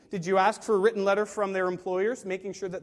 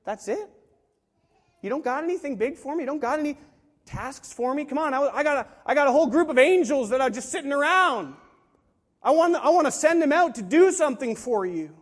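higher, animated disbelief building to loud; God, incredulous; 7 semitones higher, 7 dB louder than usual. Acted. God answering people who ask for little, incredulous and building louder. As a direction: push the offer harder as it goes. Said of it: "He's saying".

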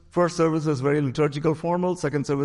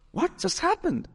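high-pitched, incredulous question; astonished; 13 semitones higher, 3 dB quieter, slower than usual. Retold or imitated. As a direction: squeak it out in mock amazement. Retells astonished reaction to healing.

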